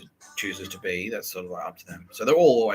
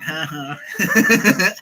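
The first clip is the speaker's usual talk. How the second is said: a mock "Ha ha", pitched higher and louder; mocking laugh; 12 semitones higher, 7 dB louder than usual. The band laughing at him crying at gigs, mock jeer. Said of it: "Tool are laughing at me now".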